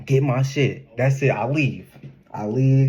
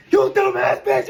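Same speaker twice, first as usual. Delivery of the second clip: sudden high, loud yelled insult; mock yell; 4 dB louder than usual. Telling friends how he answered her call, sudden high mock yell. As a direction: bark the insult into the phone.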